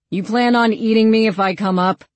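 calling up. A narrator's reading. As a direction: call up the stairs. Eli calls up to Beast.

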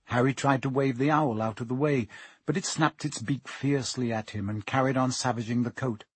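ordinary delivery, nothing marked

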